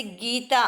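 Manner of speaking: गीता with the ई held long; long ee demo. Shown as a demonstration. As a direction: stretch the ई in गीता slowly. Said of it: "बड़ी ई के उच्चारण में अधिक समय लगता है". (गीता में ई को लंबा खींचकर)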